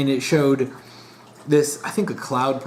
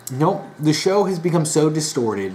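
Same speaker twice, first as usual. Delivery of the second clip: normal talking voice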